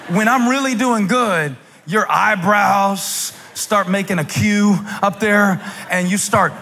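high, stretched pulpit delivery; animated preaching. Real, not acted. Preacher teasing the congregation about their body language, animated. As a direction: preach it out, lingering on phrase ends.

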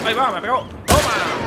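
high, loud burst of excitement; excited combat shout. Real, not acted. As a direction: shout out as the fight heats up. Recibe y devuelve golpes jugando. (grito agudo de emoción)

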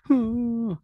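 high-pitched pretend sob; mock sad wail. Shown as a demonstration. Teacher imitates a patient suddenly sad, mock wail. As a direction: fake a drawn-out sad "huuh". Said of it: "dia sedih gitu ya".

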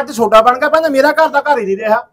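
hurt father raising his voice at his son; bitter complaint. Acted. Father complains about split family.

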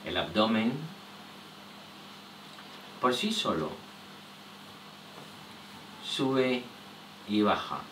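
soft, low voice in short phrases with long pauses; hushed, unhurried body-scan meditation; guided relaxation. Real, not acted.

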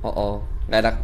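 low, soft uneasy murmur; uneasy, voice dropped low; nervous. Real, not acted. Creepy sound in game.

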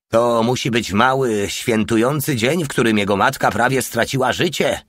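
plain reading voice

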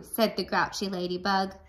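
ordinary delivery, nothing marked

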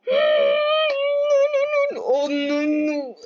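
imitating a frightened squeal; retold panic. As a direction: replay the scare as a high wail. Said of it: "I was like".

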